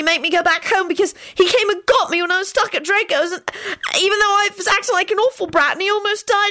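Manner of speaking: put-on high, halting boy, then rushing on; stumble, then defend someone passionately; upset young boy.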